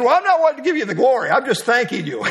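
husband's flustered laughing reply to wife; laughing protest. Retold or imitated. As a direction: protest playfully, laughing as you go. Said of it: "And I said".

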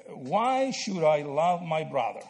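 slow, deliberate rhetorical question; slow posed question. Shown as a demonstration. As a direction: draw the question out slowly for the class. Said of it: "And answer the question".

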